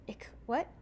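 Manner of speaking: usual speaking voice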